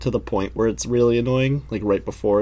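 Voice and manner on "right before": ordinary talk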